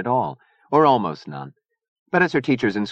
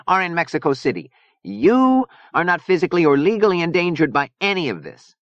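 high, emphatic character voice; tense Gomi Boy, insistent; 10 semitones higher, 3 dB louder than usual. A narrator's reading. Gomi Boy telling Zona she is not endangered, insistent. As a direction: argue the point insistently.

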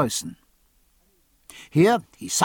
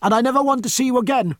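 high, fast, louder outburst; cried out; 9 semitones higher, 3 dB louder, faster than usual. A narrator's reading. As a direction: fling it at him, hurt and furious. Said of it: "she cried".

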